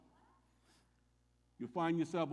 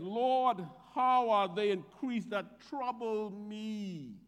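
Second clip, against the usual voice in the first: emphatic pleading cry; 5 semitones higher, 4 dB louder, slower than usual. Acted. Preacher voicing David's cry to the Lord, loud and slow. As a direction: Lord called out high and loud, then slow. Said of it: "David's being emphatic here".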